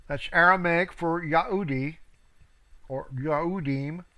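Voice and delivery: ordinary talk, nothing marked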